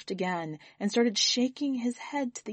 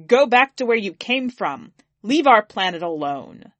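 much louder furious heckle; angry shout; 9 dB louder than usual. Acted. Heckler in the pews yells at the alien, angry shout. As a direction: yell it from the pews. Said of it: "a deep voice shouted".

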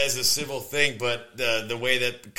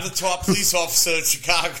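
put-on Boston accent; 5 semitones higher than usual. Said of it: "Boston accent as a Chicago cop".

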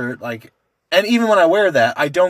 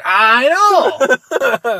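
high, loud retold exclamation; laughing, exaggerated agreement; vindicated agreement; 9 semitones higher, 7 dB louder than usual. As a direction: re-enact agreeing wholeheartedly, voice shooting up. Retelling agreeing eagerly with mother.

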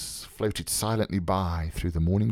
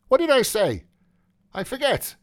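high, light voice tossing the question back; Buck Mulligan, airily forgetful; 5 dB louder than usual. Acted. Mulligan brushing off Stephen's grievance, airy and high. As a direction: lift it high, as if it hardly matters.

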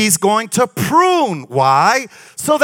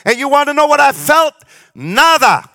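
usual speaking voice

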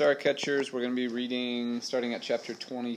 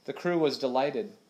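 usual speaking voice

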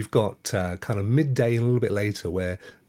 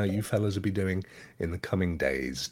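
usual speaking voice

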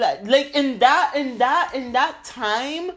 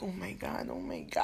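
much softer whispered oh my God; hushed panic under her breath; panicked whisper; 3 semitones lower, 15 dB quieter than usual. Retold or imitated. Panicking about man behind her.

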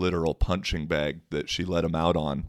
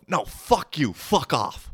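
high-pitched imitation of her hostility; hostile outburst; 8 semitones higher, slower than usual. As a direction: mimic her furious dismissal, high. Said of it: "if it's gonna be that hostile".

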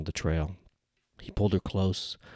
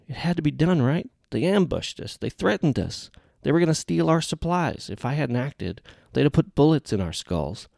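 put-on higher, pleading young man's voice; lightly voiced, defensive and insistent; young gunman pleading his case to his teammate, defensive; young soldier justifying himself; 9 semitones higher, 3 dB louder than usual.

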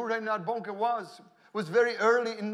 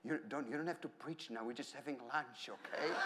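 very soft, lower voice, imitating a German wife; quiet, soft-spoken; 5 semitones lower, 14 dB quieter than usual. Wife reining in her preaching husband at lunch, quiet and soft-spoken. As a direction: hush him gently, barely raising the voice. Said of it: "very quiet, soft-spoken German lady".

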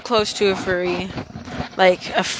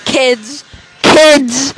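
rising louder and higher each time; building to shout; 4 semitones higher, 6 dB louder, much slower than usual. Real, not acted. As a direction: repeat it, getting louder. Repeated word building up.

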